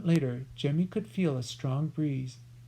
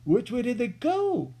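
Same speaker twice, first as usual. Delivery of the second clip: raised, high cry; cried out; 8 semitones higher, 5 dB louder than usual. A narrator's reading. Cutwater searching the dark, raised cry. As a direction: call out to the others. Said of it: "cried out Cutwater".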